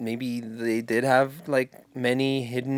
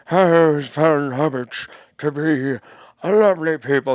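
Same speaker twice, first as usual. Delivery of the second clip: put-on wise old wizard voice, a bit louder; Gandalf impression; 5 dB louder than usual. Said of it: "That's my Gandalf".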